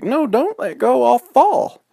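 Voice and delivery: high, loud frightened squeal; acted squealing alarm; panicked. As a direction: plead in a little girl's panic. Girl panics on the bike.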